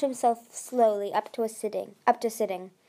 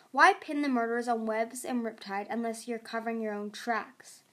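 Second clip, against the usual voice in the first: usual speaking voice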